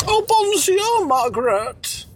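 put-on high hammy French accent; mock French voice. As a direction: ham up a silly French lover. Mock French couple role-play.

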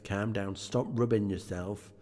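usual speaking voice

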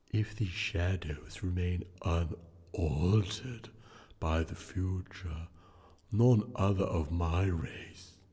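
low, slow ghostly drawl; unearthly voice. Acted. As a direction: intone it like a solemn spirit. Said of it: "returned the ghost".

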